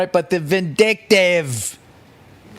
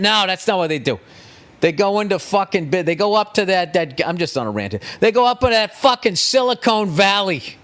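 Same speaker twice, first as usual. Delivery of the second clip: ordinary talk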